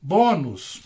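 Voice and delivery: Bônus with BÔ stressed, the ending dropping; stress on penultimate syllable. Shown as a demonstration. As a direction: lean on the first syllable of Bônus. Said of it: "penúltima é mais forte".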